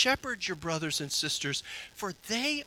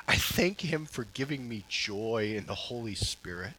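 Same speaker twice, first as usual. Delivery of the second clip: usual speaking voice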